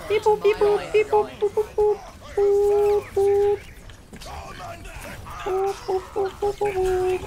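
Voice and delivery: high robotic beep-boop booting up. Pretend. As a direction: chirp like a starting machine. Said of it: "Okay, it's gonna come on".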